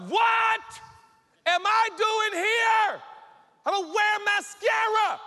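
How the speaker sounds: high, slow, loud cry of disbelief; bewildered outburst. Acted. Joe finds himself in Egypt, bewildered shouting. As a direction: wail it in comic outrage.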